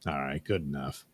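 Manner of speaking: whispered "good enough" to himself. Real, not acted. Editor settling on the filter, whispered. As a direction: breathe it out as a whisper.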